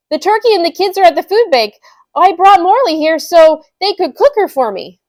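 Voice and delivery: loud, high, fast blurted explanation; flustered excuse. Acted.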